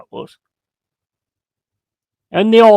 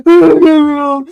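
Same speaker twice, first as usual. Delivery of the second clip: high-pitched, drawn-out mock wail; mocking crybaby; 11 semitones higher, 7 dB louder, much slower than usual. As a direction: take the piss with a slow, whiny cry. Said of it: "taking the piss out of him".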